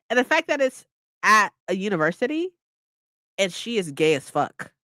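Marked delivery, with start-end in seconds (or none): none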